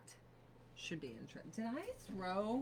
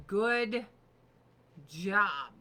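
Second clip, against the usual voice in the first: loud mock good job; 9 dB louder than usual. Mocking herself, loud sarcastic good job.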